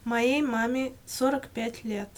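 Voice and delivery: usual speaking voice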